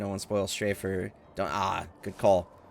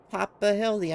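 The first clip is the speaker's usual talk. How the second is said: put-on raised childlike call of the name; Gigi's call; 11 semitones higher, 4 dB louder than usual. Grown Gigi greeting his father, high and eager. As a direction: call out high like the little boy.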